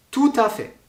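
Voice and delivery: bright demo of the T sounded before "à"; T liaison. Shown as a demonstration. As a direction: punch the joined "tou-ta fait" high. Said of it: "un T rencontre un A, ta".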